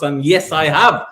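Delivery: usual speaking voice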